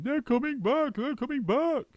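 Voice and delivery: high, frantic bird squawk; screaming bird. A narrator's reading. Roly-poly bird screaming a warning to the monkeys. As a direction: shriek it like a panicking bird.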